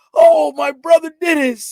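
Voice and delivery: high loud mock shout of delight; church ladies shouting. Church women greet the hugging brother, shouting high and loud. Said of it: "they be like".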